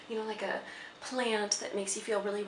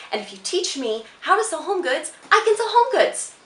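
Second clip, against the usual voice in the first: earnest pitch building to a loud, high outburst; insistent self-selling; 3 semitones higher, 5 dB louder than usual. Acted. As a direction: argue your worth, louder and higher until you burst. Acted monologue, pleading job argument escalates.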